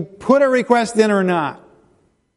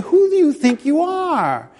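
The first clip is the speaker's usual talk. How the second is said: high, slow challenge to Satan; defiant challenge; 9 semitones higher than usual. Retold or imitated. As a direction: demand it defiantly. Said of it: "He's saying".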